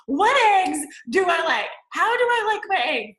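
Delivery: loud, high, baffled shout about eggs; confused shout. Retold or imitated. Julia Roberts in Runaway Bride, baffled and shouting about eggs. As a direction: blurt out the question, confused and loud. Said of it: "I feel like Julia Roberts with the eggs".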